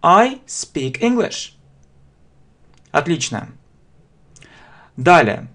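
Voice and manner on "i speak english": I speak English drawn out slowly with a high start; slow model sentence. Shown as a demonstration. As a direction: say it slowly for students to repeat. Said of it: "Вы можете повторить".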